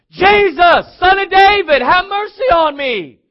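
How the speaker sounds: high, very loud drawn-out cry; desperate shout. Acted. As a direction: call out over a crowd, stretching the plea. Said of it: "and began to shout it".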